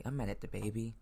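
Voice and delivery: ordinary talk, nothing marked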